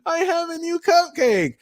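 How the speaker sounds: high-pitched hammed-up blubbering; mock crying. People who cry for everything, mock crying. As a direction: squeal it like a put-on crybaby. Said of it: "the people that'll cry for everything".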